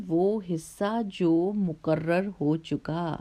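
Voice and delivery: ordinary talk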